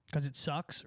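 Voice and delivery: ordinary delivery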